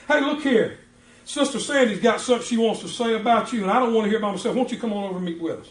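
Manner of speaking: high, loud, chirpy greeting; cheery phone greeting. Retold or imitated. Pastor calling a church member on the phone, high cheerful greeting. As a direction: sing it out bright and friendly over the phone. Pastor invites accused to meet.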